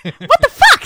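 high, loud, laughing shock; laughing high-pitched incredulity; laughing disbelief. Retold or imitated. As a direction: squeal it through laughter. Retold shocked reaction while laughing.

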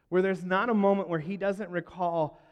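ordinary delivery

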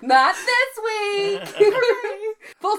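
high, loud, slow laughing; laughing hard. Real, not acted. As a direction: say it through laughter.

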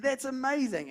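high, loud mock exclamation; retold amazement. Neighbour amazed by the chocolate, imitated loud delight.

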